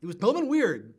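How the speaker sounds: ordinary talk, nothing marked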